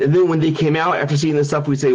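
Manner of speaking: ordinary delivery, nothing marked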